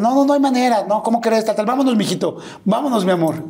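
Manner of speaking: lightly acted brush-off, easing into coaxing; mild insistent refusal. Retold father refusing to leave.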